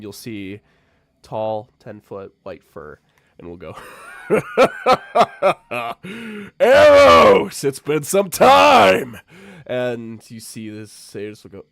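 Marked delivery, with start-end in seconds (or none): 4.3-9.2 put-on big, high, roaring welcome to an old friend; huge overjoyed shout across the room; booming greeting. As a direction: bellow it out with delight, slowly. Lion greets old friend loudly.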